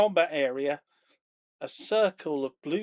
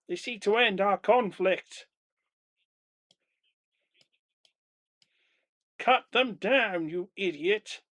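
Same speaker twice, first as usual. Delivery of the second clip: higher, louder dragon command; furious dragon; 5 semitones higher, 3 dB louder than usual. Acted. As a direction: bark the kill order, enraged. Dragon orders the kill.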